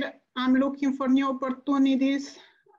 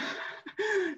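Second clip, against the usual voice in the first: a small choked laugh-sob, high and soft; tearful laugh; 7 semitones higher, 8 dB quieter than usual. Real, not acted. [name] moved to tears before the parents, half-laughing, half-crying. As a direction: let a real tear break into a quiet laugh. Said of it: "I'm very emotional".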